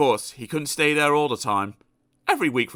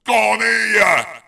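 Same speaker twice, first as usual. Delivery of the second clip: much higher, very loud call; thundering grocer; 10 semitones higher, 8 dB louder than usual. Acted. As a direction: boom it out. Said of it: "bellowed the greengrocer".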